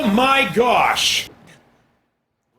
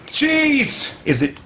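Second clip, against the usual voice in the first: usual speaking voice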